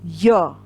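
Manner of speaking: य said clearly from the palate; palatal letter. Shown as a demonstration. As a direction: sound य off the roof of the mouth. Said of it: "य यानी कि तालव्य है ये". (य तालु से)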